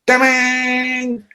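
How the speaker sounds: high ringing shout of a guitar chord; guitar crash shout. Retold or imitated. Rock writers answering the phone, high shouted guitar-crash noise. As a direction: belt it like a power chord. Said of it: "Or it could be".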